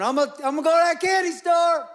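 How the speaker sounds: put-on high, loud little boy voice; excited kid. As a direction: squeal it like an eager young son. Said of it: "I can hear him in the background going".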